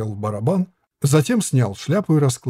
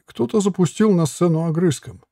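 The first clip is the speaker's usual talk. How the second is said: ordinary delivery, nothing marked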